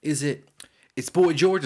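tentative quiz-answer imitation; unsure guess. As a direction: imitate coworkers venturing a halting answer. Coworkers guessing in retold quiz.

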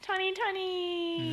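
slow drawn-out announcer call. Acted. Podcast intro announcement.

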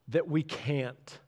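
normal talking voice

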